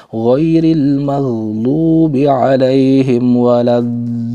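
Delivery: slow chanted recitation, dallin pressed; Quranic recitation. Shown as a demonstration. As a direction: recite slowly, lifting the doubled l. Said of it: "suaranya ditekan sehingga suara agak". (tilawah pelan dengan tekanan di dallin)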